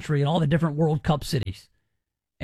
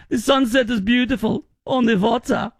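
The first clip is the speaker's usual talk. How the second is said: hammed-up German accent, pitched up; mock German accent; 4 semitones higher than usual. Host voicing a German visitor at sunset, mock German accent. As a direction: play a delighted German tourist, higher. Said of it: "you should say in a German accent".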